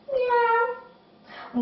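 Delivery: very high, soft imitated meow; a small, high-pitched kitty meow; cat meow. Shown as a demonstration. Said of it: "come back into our cat".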